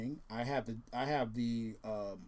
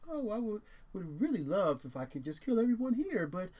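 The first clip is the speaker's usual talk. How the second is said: lightly voiced prim robot, high and polite; a hint of a cheerful killer butler droid; mild imitation of a polite British robot; polite evil droid; 6 semitones higher, 3 dB louder than usual. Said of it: "C three P O with his really polite ... British accent".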